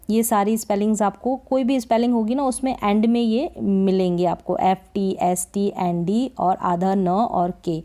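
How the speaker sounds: normal talking voice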